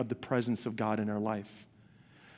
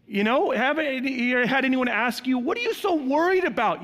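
mock annoyed; 11 semitones higher, 6 dB louder than usual. Mimicking annoying question.

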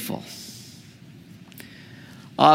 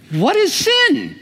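rhetorical question; slower than usual. Acted.

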